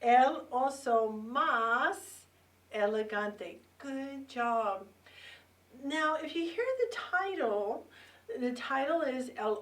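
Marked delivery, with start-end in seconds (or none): 0.0-3.6 El Oso Más drawn out slowly and loud for repeating; slow teacher model. Shown as a demonstration. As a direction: stretch out El Oso Más syllable by syllable. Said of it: "Say it with me".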